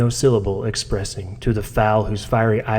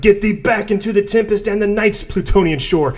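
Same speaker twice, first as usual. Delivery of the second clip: shrieked; 11 semitones higher than usual. Acted. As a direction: drive the raven out, shrieking. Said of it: "I shrieked upstarting".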